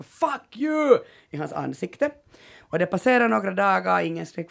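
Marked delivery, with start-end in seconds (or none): 0.0-1.0 high-pitched mock yell; retold scream. Storyteller voicing people shouting at Lance, mock scream. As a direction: imitate strangers screaming an insult. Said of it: "kommer folk att stå och skrika".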